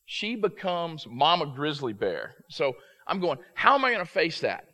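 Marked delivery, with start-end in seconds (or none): 3.5-4.6 loud, high, panicky worry; retold panic. Father panicking after losing his son at the game. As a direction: relive the rising panic of a lost child. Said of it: "I'm in a panic".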